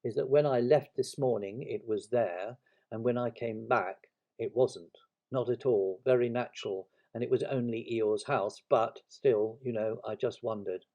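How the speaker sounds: ordinary delivery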